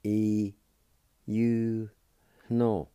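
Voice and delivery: "E, you, no" said as three separate syllables with pauses; one clear syllable at a time: "E, you, no". Shown as a demonstration. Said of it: "pronounced like this".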